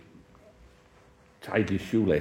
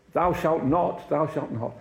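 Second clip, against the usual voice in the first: higher stern "Thou shalt not", pitched up and declaimed; mock strict preacher, raised and pronounced; hammed-up hardliner; 7 semitones higher than usual. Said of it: "somebody was a hardliner".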